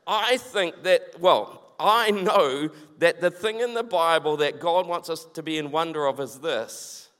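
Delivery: normal talking voice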